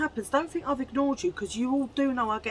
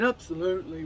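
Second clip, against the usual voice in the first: imitating boyfriend. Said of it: "He sort of said it like that".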